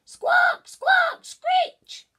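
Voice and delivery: very high, loud bird screech; shrieking parrot cries; frightened parrot squawk. A narrator's reading. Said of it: "in fright".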